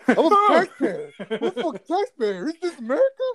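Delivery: high, loud, laughing entitled outburst; Randy Marsh impression. Friend doing Randy Marsh yelling about being a taxpayer, high and loud. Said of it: "I just go Randy Marsh".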